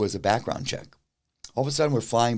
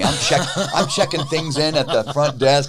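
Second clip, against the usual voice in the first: laughing while talking; 5 semitones higher, 6 dB louder than usual. Real, not acted. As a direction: laugh through the words, higher and louder. Laughing while retelling the panic.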